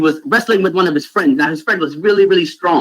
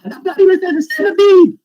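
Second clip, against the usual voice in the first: mocking friends. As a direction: scoff at him like a buddy. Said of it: "We're like".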